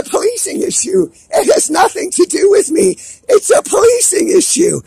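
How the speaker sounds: indignant shout at the government; furious. Real, not acted. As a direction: spit it out in real fury. Furious rant at the prime minister.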